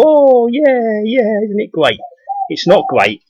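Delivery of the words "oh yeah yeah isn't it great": much higher, gushing put-on voice; mock gushing listener. Mocking people charmed by stage-Irish talk, gushing falsetto. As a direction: mimic a gullible fan cooing. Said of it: "they go".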